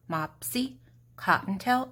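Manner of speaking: plain reading voice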